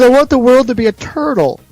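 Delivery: high, teary imitation of a gentle big guy; crying hippie voice. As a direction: whine it like a sobbing peace-lover. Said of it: "He goes".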